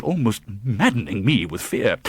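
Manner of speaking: usual speaking voice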